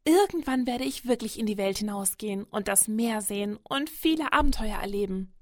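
excited youngster, bright-eyed dreamer; gushing. A narrator's reading. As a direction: rave about a wonderful dream. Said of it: "schwärmt Leon".

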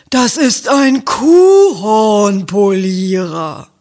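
slow, loud declaration; loud cow proclamation. A narrator's reading. Rosa the cow insisting to Ferdinand, loud and slow. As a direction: announce it slowly and loudly like a proud cow.

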